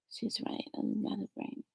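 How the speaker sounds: whisper. Real, not acted. Aside answering spirit mother.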